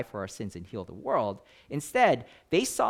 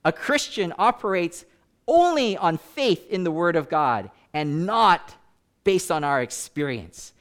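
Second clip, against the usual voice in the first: higher, louder, slow preacher's proclamation; quoting another preacher; 5 semitones higher, 7 dB louder than usual. Retold or imitated. Retelling how another preacher, holding up the Bible, proclaimed it, higher, louder and slow. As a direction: boom it out slowly like a pulpit preacher laying down the law. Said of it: "another preacher held up the Bible and said".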